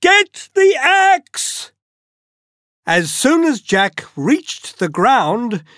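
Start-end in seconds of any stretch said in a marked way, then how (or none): 0.0-1.7 high, drawn-out shout to mother; urgent yell. A narrator's reading. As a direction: call it out slow and very high. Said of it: "yelled Jack to his mother".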